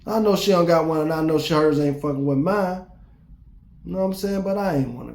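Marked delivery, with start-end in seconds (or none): none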